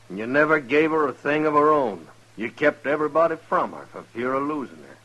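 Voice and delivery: righteous anger at the brother; loud accusation. Acted. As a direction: lay into him loudly.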